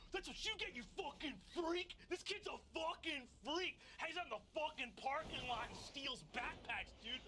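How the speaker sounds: high-pitched, jeering school bully; hammed-up bully tirade; Rog Barkrock. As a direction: taunt him in a high, worked-up voice. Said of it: "Rog Barkrock is behind you and goes".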